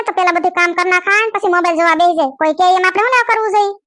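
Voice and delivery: normal talking voice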